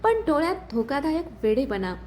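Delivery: plain reading voice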